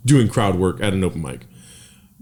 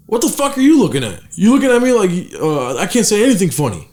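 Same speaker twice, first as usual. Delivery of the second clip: impression of a heckling comic; 8 semitones higher than usual. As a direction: snap at the guy staring at you like a rude comic. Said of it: "this is how my impersonation of everyone".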